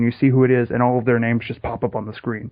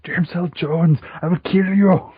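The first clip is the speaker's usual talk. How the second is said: higher-pitched hammed-up threat; mock villain threat; 7 semitones higher, 4 dB louder, slower than usual. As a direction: play a silly movie villain. Acting out a movie villain.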